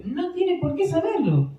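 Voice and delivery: usual speaking voice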